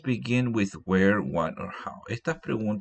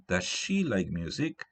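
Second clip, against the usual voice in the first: "she" lifted out of the question, rising ending; stress on SHE. Shown as a demonstration. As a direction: punch the swapped word "she". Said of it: "En vez de he".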